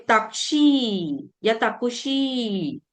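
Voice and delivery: ताक्षी drawn out slowly, then ताकुशी; slow teacher demo of ताक्षी; slow pronunciation demo. Shown as a demonstration. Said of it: "like this".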